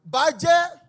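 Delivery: loud, high cry, slow on "go, go dive it in"; mock rallying yell. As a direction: urge the raiders on. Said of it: "The people were coming and say".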